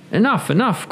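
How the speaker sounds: high sharp donkey outcry; donkey crying out. Acted. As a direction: cry out to silence the rooster. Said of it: "cried the donkey".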